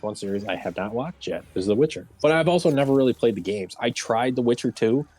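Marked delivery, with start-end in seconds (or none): none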